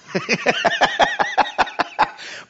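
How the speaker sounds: long high-pitched cackling laugh; real giggling cackle; gleeful laugh. Real, not acted. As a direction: burst into a high, drawn-out laugh. Laughs at own remark.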